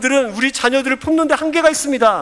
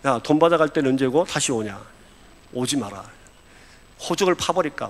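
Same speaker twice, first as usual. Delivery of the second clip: low, softened grumble of rejection; understated, low-voiced scolding; mock disapproving father; 9 semitones lower, 5 dB quieter than usual. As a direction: play a fed-up father turning his child away, under the breath. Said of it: "저 같으면 뭐라 그럽니까".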